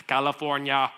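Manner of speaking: loud mock Austrian accent; imitated Austrian-accented mispronunciation of California; Arnold Schwarzenegger. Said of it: "He couldn't even say the name".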